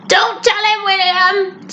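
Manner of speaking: high, muffled plea; mumbled. A narrator's reading. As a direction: struggle to get words out through a mouthful of beard. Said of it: "Santa mumbled".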